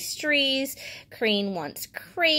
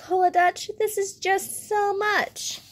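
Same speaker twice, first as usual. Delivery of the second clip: high protest, slowing on the last words; lightly acted exasperation, pitched well up; mock overwhelmed; 10 semitones higher than usual. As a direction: playfully voice a storybook bear who is overwhelmed. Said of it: "Bear says".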